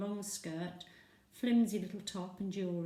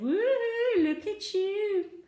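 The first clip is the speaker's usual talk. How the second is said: high, loud mock whoop then slow drawn-out tease; teasing whoop; 14 semitones higher, 10 dB louder, much slower than usual. Molly teasing her friend, high loud whoop.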